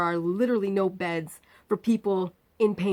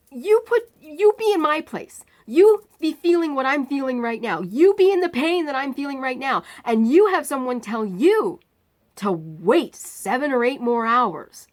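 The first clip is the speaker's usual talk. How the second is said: louder and higher, slowing at the end; retold angry plea; 6 semitones higher, 8 dB louder than usual. Patient demanding that the surgeon imagine her pain, retold angry plea. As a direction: lecture her loudly, furious.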